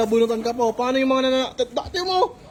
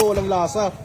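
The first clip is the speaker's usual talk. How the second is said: usual speaking voice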